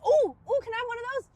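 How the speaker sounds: put-on high-pitched excited kid; eager child. Child asking for a candy bar, eager and high. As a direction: plead like a child wanting a treat. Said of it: "when I was growing up".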